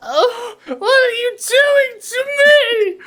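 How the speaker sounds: high, slow, anguished wail; wailing old lady. Acted. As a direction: cry out in pain like a frail old woman. GM voices old lady being punched.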